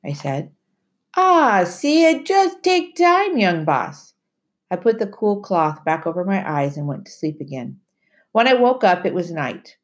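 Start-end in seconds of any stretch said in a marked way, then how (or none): 1.2-4.1 Timothy's voice. Acted. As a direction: warn the boy like an old island sailor. Timothy's voice in the story.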